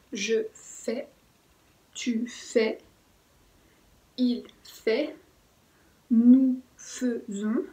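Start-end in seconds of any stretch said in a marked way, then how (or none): 6.1-7.6 "nous faisons" drawn out very slowly and louder, "fai" said like an E; slow pronunciation demo. Shown as a demonstration. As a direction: stretch out "faisons" as fe-zon for the class. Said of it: "lo pronunciamos más como si fuera una E". ("nous faisons" muy lento y más fuerte, con "fai" como E)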